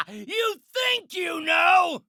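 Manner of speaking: high, slow, furious taunt; scornful shout. Acted. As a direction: stretch the taunt out in a yell. Villain taunts opponent.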